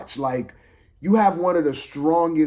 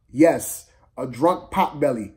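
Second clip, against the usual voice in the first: usual speaking voice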